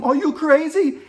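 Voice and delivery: high, loud incredulous shout; imitated chief mate hollering; retold yell. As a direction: yell down at a pirate in reenactment. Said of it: "I yelled down to him".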